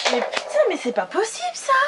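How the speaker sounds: high-pitched exasperated outburst; real exasperation. Real, not acted. Vlogger whose products keep falling mid-video, exasperated. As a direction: voice leaps up in disbelief and annoyance. Products fall while she films.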